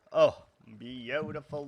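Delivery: usual speaking voice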